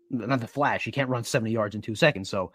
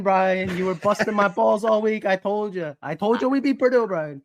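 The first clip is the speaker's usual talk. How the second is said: put-on lightly higher New York wiseguy accent; Joe Pesci impression; 7 semitones higher than usual. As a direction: needle Brian like a mobster. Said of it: "going a little Joe Pesci".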